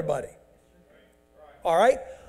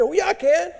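defiant high retort; 9 semitones higher than usual. Acted. Acted quarrel at the temple.

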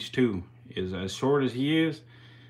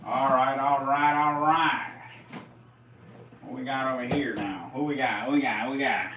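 usual speaking voice

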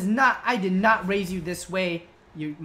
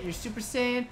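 usual speaking voice

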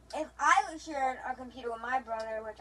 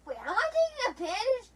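shrill raised question; high, loud protest; 9 semitones higher, 7 dB louder than usual. Real, not acted. Questioning a teammate mid-dispute, high loud protest.

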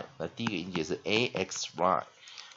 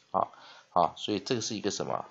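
ordinary talk, nothing marked

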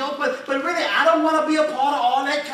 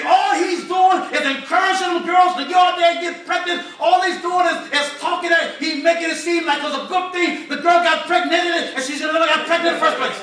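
higher, louder griping complaint; retold angry rant; 6 semitones higher, 5 dB louder than usual. Brother Brown complaining about the preacher, loud indignant griping. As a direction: vent like a church member fed up with the preacher.

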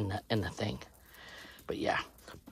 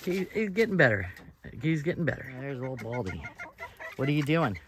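ordinary talk

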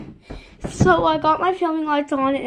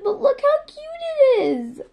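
cutesy; 12 semitones higher than usual. Real, not acted. Showing off the plush.

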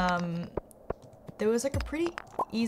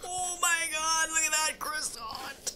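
awed villager; 7 semitones higher, 4 dB quieter, slower than usual. Acted. Awed at food.

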